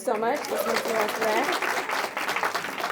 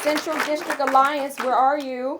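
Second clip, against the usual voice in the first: louder, higher, calling across the room; 6 semitones higher, 9 dB louder than usual. Real, not acted. Host calling on a sponsor table to make noise, loudly. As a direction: summon the table to cheer.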